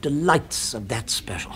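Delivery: hushed, reverent whisper. Acted. Host to his guest about the legendary special, in a whisper. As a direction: breathe it like a precious secret. Confiding about the secret dish.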